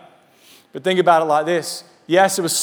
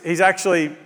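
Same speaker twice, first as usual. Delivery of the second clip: normal talking voice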